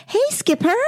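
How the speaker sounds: high-pitched put-on American voice; American accent. Mum playing Barbies with her daughter, in a high American accent. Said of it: "She did an American voice".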